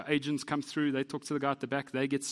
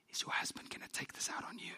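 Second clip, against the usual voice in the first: higher, hushed, half-laughing whisper; stage whisper; 10 semitones higher, 10 dB quieter than usual. Retold or imitated. Clerk quietly asks wife about her husband, concerned stage whisper. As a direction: whisper the concern like it's a secret. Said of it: "in a stage whisper".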